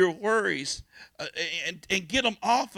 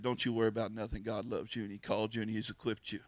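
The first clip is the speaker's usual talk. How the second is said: soft reassurance; 8 semitones lower, 9 dB quieter, faster than usual. Retold or imitated. Pastor quietly reassuring the frightened new minister. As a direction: lower and softer, gentle comfort.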